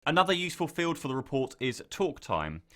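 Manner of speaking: ordinary delivery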